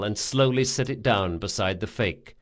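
ordinary delivery, nothing marked

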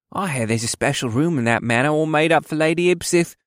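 high-pitched, smirking insinuation; smirking elf soldier. A narrator's reading. Dark-haired elf gossiping to soldiers, smirking. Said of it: "the dark-haired elf smirked".

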